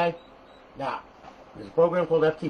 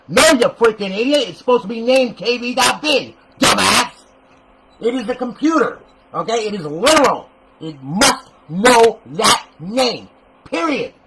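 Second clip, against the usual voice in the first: loud, higher exasperated scolding; angry rant; 7 semitones higher, 10 dB louder than usual. Real, not acted. Tutor ranting at careless users, real loud exasperation. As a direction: chew them out for the misnamed file. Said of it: "I'm done with that rant".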